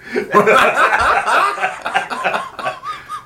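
shrieking burst of laughter; loud high laugh. Real, not acted. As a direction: howl with helpless laughter. Table erupts at the pun.

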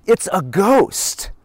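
high, slow, frightened cry of a ghost sighting; slow drawn-out cry of fear; terrified cry. A narrator's reading. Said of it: "they cried out".

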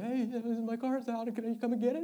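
high, unnatural phone-call voice; strained high voice. Retold or imitated. Nervous boyfriend phoning for a jump start, strained high voice.

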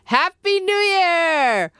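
high, drawn-out festive cheer; celebratory call. Acted. Host calls out holiday greeting.

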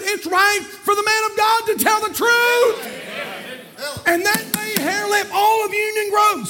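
high, loud, shouted preaching; preaching at full force. Acted. Preacher to his congregation about telling the truth, shouted preaching. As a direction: hammer the truth home to the church.

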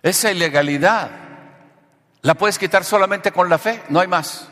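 high, loud, emphatic sermon; full-force preaching. Real, not acted. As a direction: hammer it out to the congregation, stretching key words.

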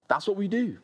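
ordinary delivery

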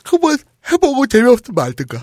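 talking through a yawn; mid-yawn, words stretched. Real, not acted. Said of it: "하품하면서 얘기하지 마". (하품하면서 말하기)

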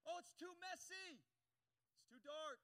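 high, drawn-out mock complaint; shy away with exaggerated reluctance; mock avoidance. Preacher mimicking the priest avoiding trouble, mock reluctance. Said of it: "This is what the priest did".

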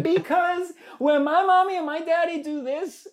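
put-on high, loud kid voice breaking into laughter; a little clown leaning into the laughs; cheeky child. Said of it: "And then I'd be like".

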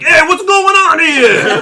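high, loud, slow hammed-up mobster; goofy gangster. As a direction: play a cartoonish goon, laughing. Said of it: "the Goomba gangsters".

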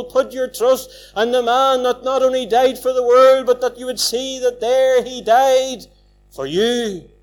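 loud, driving gospel appeal; fervent preaching. Real, not acted. Preacher pleading with sinners to turn to Christ, loud and fervent. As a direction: preach it hard, pressing the crowd to turn.

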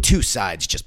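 usual speaking voice

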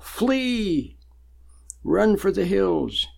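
high, drawn-out call to escape; urgent warning cry. Acted. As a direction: stretch it out high like an alarm.

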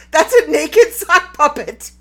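loud, slowed words bursting into laughter; can't get the line out for laughing; cracking up mid-sentence; laughing through it. Real, not acted. Cracking herself up.

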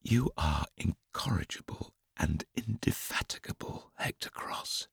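soft, unvoiced mock-prim whisper. A narrator's reading. Joe primly whispering at Hector in bed. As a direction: scold him primly in a whisper. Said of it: "she told him primly".

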